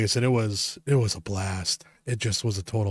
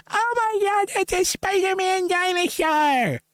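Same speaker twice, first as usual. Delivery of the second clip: very high, drawn-out squeal; mock excited kid. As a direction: imitate a thrilled child calling from houses away. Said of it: "they're excited".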